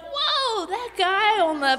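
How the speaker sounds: very high, slow awed whoa; amazed. Acted. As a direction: squeal it in wonder. Disciples amazed by catch.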